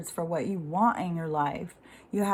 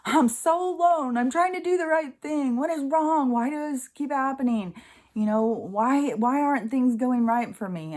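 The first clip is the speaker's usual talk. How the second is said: high-pitched, wailing self-pity; re-enacted anguish, voice pitched well up; despairing plea; 7 semitones higher, 3 dB louder than usual. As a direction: act out a desperate plea to God. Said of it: "having one of those moments".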